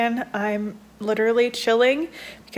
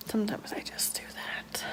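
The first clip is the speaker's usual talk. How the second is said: whisper; 14 dB quieter than usual. Real, not acted. Confiding aside about her habit.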